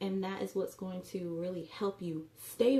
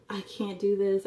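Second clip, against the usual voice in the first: louder mock wail on "I can't do this"; hammed-up despair, pushed out loud; 2 semitones higher, 7 dB louder than usual. Mocking a dieter's complaint.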